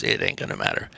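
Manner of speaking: low hushed whisper. Retold or imitated. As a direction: murmur it under your breath. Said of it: "he goes".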